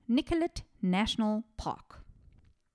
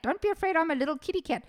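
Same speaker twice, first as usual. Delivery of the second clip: higher, sing-song mocking version; mocking repeat; 7 semitones higher, faster than usual. Host mocking the killer's words to listeners, sing-song. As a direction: parody the creepy line.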